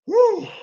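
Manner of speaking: high, joyful woo; whooping, still buzzing from the game; excited whoop. Real, not acted. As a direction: let out a big celebratory whoop.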